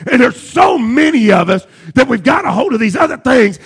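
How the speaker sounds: driving sermon cadence with punched key words; full-force preaching. Acted. Preacher urging the church to lay sins aside, impassioned preaching. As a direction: preach it hard, rolling through each repetition.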